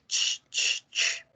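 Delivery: CH as an unvoiced shushing hiss; sonido del silencio. Shown as a demonstration. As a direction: hiss CH like "shh". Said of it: "el sonido del silencio". (CH como shh de silencio)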